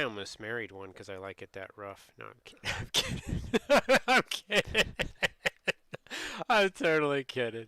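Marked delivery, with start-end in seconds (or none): 2.6-4.9 cracking up through the words, repeating the denial; giggling too hard to finish the sentence; real laughter breaking up the words; laughing at own joke. Real, not acted. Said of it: "laugh at your own joke". 6.5-7.7 louder laughing. Real, not acted. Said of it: "laugh at your own joke".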